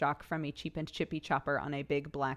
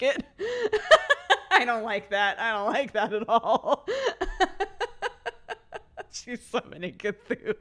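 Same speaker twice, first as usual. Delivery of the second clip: laughing; 5 semitones higher, 6 dB louder than usual. Real, not acted. Laughing at her own gibberish.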